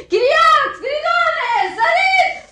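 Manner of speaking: very high, loud panicked calling; shrill alarmed cries to the household; screaming for help. Acted. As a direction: shout each name out for rescue. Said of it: "Nu striga".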